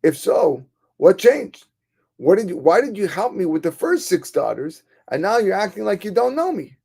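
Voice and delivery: high, loud, alarmed questioning; frightened alarm. Acted. Frightened man questions Satan about the change, alarmed. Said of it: "He was frightened".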